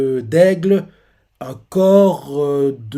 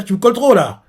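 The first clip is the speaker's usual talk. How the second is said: sudden higher, louder snap; retold annoyed complaint; 7 semitones higher, 6 dB louder than usual. Ase tells Zuma he stands too close, annoyed. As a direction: push him off, half-joking.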